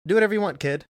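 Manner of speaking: imitating an old star shrugging off a newcomer; dismissive brush-off. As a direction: wave the kid off, loud and lifted. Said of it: "He's like".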